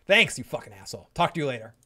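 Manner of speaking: ordinary talk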